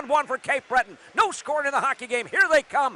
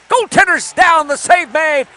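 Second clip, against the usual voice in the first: loud save call; 3 semitones higher, 8 dB louder than usual. Real, not acted.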